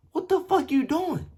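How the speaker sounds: high, exasperated incredulous yell; retold outrage. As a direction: challenge the roommate in disbelief. Confronts roommate lying down.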